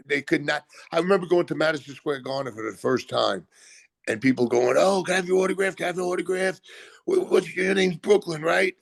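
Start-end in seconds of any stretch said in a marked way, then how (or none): 4.8-8.8 much higher, starstruck and naive; mock fan voice. Fans at Madison Square Garden asking for an autograph, much higher mock gushing voice. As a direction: play a clueless, gushing fan.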